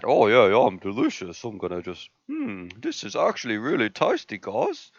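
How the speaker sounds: soft, muffled voice for the dog chewing a treat; puppy talking. Pretend. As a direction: speak for the puppy, hushed and content. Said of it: "I like how you imitate your puppy talking".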